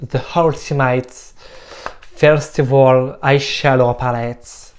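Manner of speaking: palette name said slowly in a put-on English accent. Shown as a demonstration. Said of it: "avec l'accent, euh, English". (avec un accent anglais appuyé)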